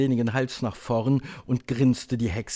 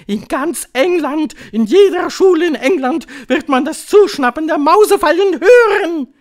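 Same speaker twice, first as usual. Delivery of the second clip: high, loud ranting witch; piercing, wild proclamation; frenzied Grand High Witch; 10 dB louder than usual. A narrator's reading. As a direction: shout it gleefully as a witch leader, building to a yell.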